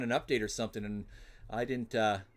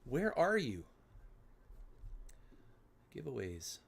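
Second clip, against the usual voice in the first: ordinary talk, nothing marked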